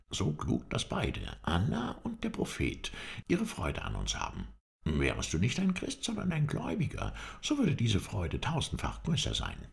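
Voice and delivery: lightly voiced companion with high pitch leaps; self-important loyal servant. A narrator's reading. Halef saves face before his master, self-important. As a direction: make a grand, high-pitched case for shared cleverness.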